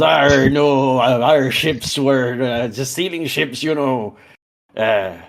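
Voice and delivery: put-on pirate accent. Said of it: "stereotypical pirate, then he goes".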